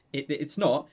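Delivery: ordinary talk, nothing marked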